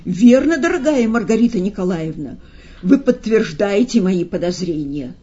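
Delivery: usual speaking voice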